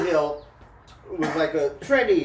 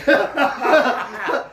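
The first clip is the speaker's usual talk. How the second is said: loud laugh; 7 semitones higher, 6 dB louder than usual. Real, not acted. Laughing at parking story.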